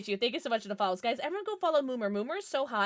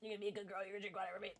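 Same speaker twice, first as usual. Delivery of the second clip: much softer, near-whispered coaxing; soft teasing coax; 5 semitones lower, 16 dB quieter, much faster than usual. Acted. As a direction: drop to a hush and coax her gently. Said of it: "I'm gonna test our relationship right now".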